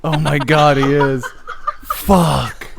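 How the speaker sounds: loud, delighted disbelief; loud surprise. Real, not acted. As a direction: burst out in amazed laughter. Spots the guy among dislikes.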